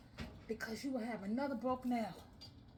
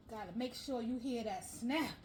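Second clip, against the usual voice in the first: ordinary talk, nothing marked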